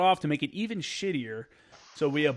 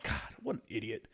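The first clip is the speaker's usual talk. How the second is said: self-reproach; 5 semitones lower, 11 dB quieter than usual. Retold or imitated. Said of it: "I'd just be like".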